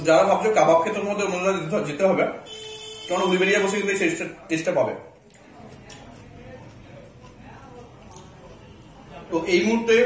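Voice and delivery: chewing while talking; muffled by food; mouth full. Real, not acted. Seen 3: Bites food at -1.0, then talks with jaw working throughout.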